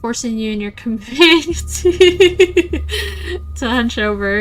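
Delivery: narration slowed, laughing between words; can't keep a straight face reading it; laughing through it. Real, not acted. Reading the narration to viewers while laughing.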